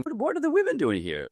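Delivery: very high, bewildered inner question; high, puzzled disbelief; baffled. Retold or imitated. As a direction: squeak out his confusion. His thought entering the workshop.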